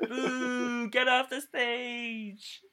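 rowdy mock booing, laughing; loud drunk heckling. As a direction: boo them off the stage.

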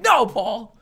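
loud, high refusal; hammed-up protest; mock defiant shout. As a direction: yell back at the host. Comic defies host, retold quarrel.

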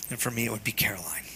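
fast, breathy whispered confession; real grief, whispered. Real, not acted. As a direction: let the name slip out in a whisper. Grieving father names his lost daughter.

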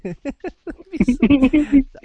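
high, loud cackling laugh; loud burst of laughter. Real, not acted. Laughing hard at the Dave Chappelle Star Wars idea. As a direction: crack up at the image.

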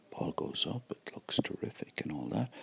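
hushed golf commentator whisper. Real, not acted. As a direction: breathe it out under your breath. Hushed golf call of shot.

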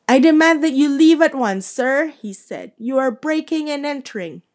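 a blustering uncle demanding he leave; indignant demand. Acted. Vernon demands intruder leave.

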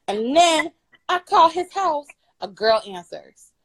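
usual speaking voice

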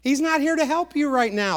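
high-pitched mocking taunt; acted scornful challenge. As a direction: jeer at a believer, pitch lifted. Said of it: "he's being mocked by those around".

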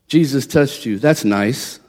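low, quiet and unimpressed; dismissive doctor. Retold or imitated.

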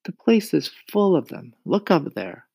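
put-on boy voice. A narrator's reading. James explains.

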